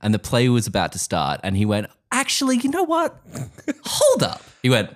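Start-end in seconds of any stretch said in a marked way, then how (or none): 2.1-4.4 high put-on statesman voice; Abraham Lincoln. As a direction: play a hammy Lincoln at a play. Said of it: "That's my, uh, Abraham Lincoln".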